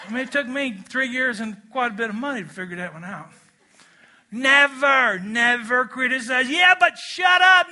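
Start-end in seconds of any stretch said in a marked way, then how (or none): 4.3-6.5 never hit hard, loud and high; NEVER said loud with a falling tune; stress on never. Shown as a demonstration. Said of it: "Say it with me". 6.5-7.7 mock objection. Mocking a listener's objection.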